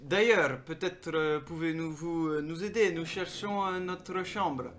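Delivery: halting, careful voice of a foreign visitor; hesitant stranger with drawn-out words; foreign traveller. Acted. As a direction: answer politely, slowly, feeling for each word.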